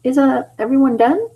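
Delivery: high, gentle Tails voice. Acted. Tails timidly asking the others, high. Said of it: "I've gotta also do the tone".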